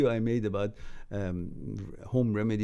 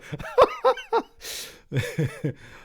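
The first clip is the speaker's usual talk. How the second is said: a high, louder burst of laughter; 5 dB louder than usual. Real, not acted. Laughing at a chat joke, high and loud.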